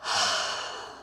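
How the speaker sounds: long breathy exhale; out-of-breath sigh. Real, not acted. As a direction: let out a winded sigh. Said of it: "I'm so out of breath".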